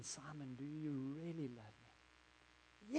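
soft and slow, pressing gently; Jesus asking again. Acted. Jesus asking Peter a second time, slow and soft. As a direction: draw the question out, quiet and searching. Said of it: "he says it again".

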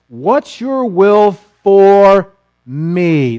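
very slow, leaning on "for me"; stress on for me. Shown as a demonstration. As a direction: drag out "What's your will for me?" so "for me" stands out. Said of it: "notice what the emphasis is".

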